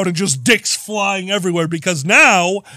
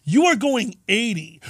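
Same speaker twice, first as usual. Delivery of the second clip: usual speaking voice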